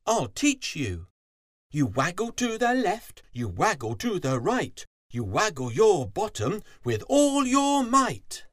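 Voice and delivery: loud, high, sing-song instruction; lively fox, swooping pitch; cheery fox teacher. A narrator's reading. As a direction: coach the bee with bouncy gusto. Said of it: "He smiled and said".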